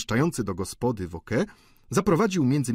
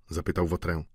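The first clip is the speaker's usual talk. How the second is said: plain reading voice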